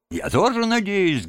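eager, high-pitched hearty reply; heartfelt agreement. A narrator's reading. Rat answering sick Toad, fervent agreement. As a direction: chime in warmly and eagerly. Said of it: "горячо откликнулся Крысси".

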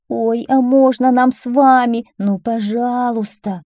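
high, drawn-out begging; pleading. A narrator's reading. Whiners begging the garbage man to take them, pleading. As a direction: plead like a whining child.

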